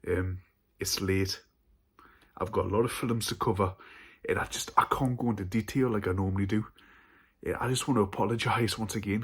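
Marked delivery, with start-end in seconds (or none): 2.4-9.2 rushing, fast apologetic pace. Real, not acted. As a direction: hurry through it. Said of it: "I'm going a bit fast".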